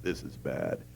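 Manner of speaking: low, grave lawyer voice; a hint of a grim attorney; lightly voiced ominous lawyer. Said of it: "in this lawyer voice".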